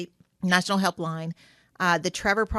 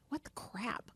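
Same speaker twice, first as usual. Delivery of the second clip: a sharp whispered what the crap; a breathless whisper of disbelief; whispered exasperation; 2 semitones higher, 16 dB quieter than usual. Retold or imitated. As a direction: hiss it under your breath, baffled. Exasperated self-talk in a retold quote.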